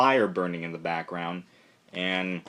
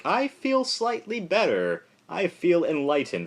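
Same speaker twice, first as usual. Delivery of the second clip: dreamy indifference; 9 semitones higher than usual. Retold or imitated. The team, indifferent after the vision, in a high dreamy voice. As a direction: float it out, blissfully vacant. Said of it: "kind of indifferent now".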